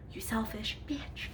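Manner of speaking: slow, soft, breathy aside; whispered insult. Retold or imitated. Angry niece whispering an insult about her cousin, slow and hushed. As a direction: say it under your breath so she can't hear.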